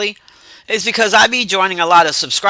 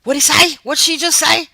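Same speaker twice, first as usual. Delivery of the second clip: much higher, puzzled asking; confused; 10 semitones higher than usual. Retold or imitated. Retelling straining to follow the heavy accent, confused. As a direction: squeak it out, lost at the accent. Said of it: "And I was like".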